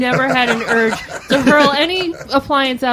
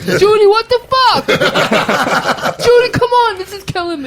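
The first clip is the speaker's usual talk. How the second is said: laughing hard through the words; cracking up, high and loud; 11 semitones higher, 6 dB louder than usual. Real, not acted. Teasing friend, laughing hard.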